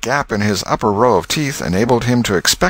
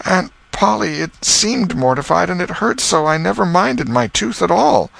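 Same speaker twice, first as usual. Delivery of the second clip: put-on sheepish boy; 11 semitones higher than usual. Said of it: "felt a little foolish".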